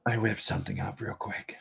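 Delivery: quiet tender reply; soft confession. Acted. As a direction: murmur it gently to her. GM voicing Sprocket, soft confession.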